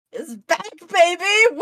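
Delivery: high, whooping excitement; hyped announcement. Pretend. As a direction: announce a big comeback with glee. Hyped announcement of villain's return.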